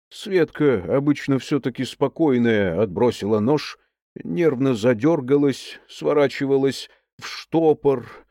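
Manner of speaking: usual speaking voice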